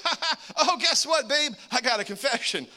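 high, nervous giggly confession; husband giggling nervously while confessing to his wife; nervous laughing. Retold or imitated.

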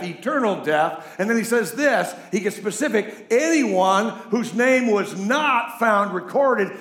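loud, high, slow fire-and-brimstone reading; preaching scripture. Acted. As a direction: thunder the judgment verses. Reading Revelation with full force.

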